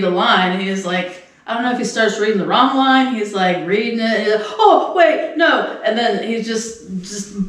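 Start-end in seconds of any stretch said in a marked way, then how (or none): 4.6-5.8 high-pitched mock self-correction; imitated flustered reader. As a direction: act out catching your own mistake. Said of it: "tripping over his own".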